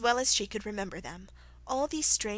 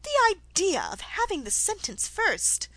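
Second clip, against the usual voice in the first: high-pitched indignant exclamation with big swoops; loud protest; 11 semitones higher, 4 dB louder than usual. A narrator's reading. Alice objecting to the Queen's order of trial, loudly indignant. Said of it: "said Alice loudly".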